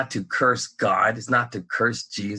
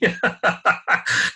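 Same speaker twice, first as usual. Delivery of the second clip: short burst of laughter; 6 semitones higher than usual. Real, not acted. Speaker cracks up before insisting it's true, laughing. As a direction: let out a quick, real laugh.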